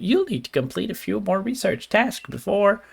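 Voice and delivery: lilting, swooping professor voice; singsong scientist with jumping pitch; cartoon professor. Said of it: "I already started with that voice".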